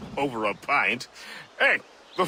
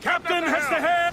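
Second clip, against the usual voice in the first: high shouted call across the deck; 10 semitones higher than usual. Acted. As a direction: shout it out to the crew. Crew calls out at sea.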